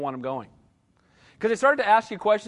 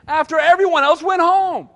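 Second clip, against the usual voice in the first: loud, higher punchline; emphatic preaching; 9 semitones higher, 9 dB louder than usual. Real, not acted. Preacher hammering the punchline to his congregation, loud. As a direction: drive the point home to the congregation.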